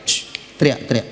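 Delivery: breathy aside; whisper. Shown as a demonstration. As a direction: hush it down. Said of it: "whispered aside".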